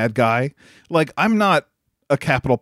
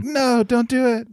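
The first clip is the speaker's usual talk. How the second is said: pleading Sakura; 11 semitones higher than usual. Retold or imitated. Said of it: "And Sakura's like".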